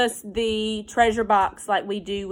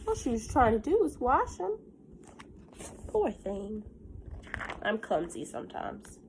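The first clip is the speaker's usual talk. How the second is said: normal talking voice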